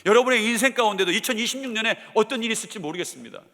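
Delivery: ordinary talk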